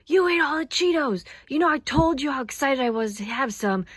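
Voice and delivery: high, loud angry accusation; mad. Acted. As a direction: confront the snack thief, hurt and mad. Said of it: "I'm so mad at you".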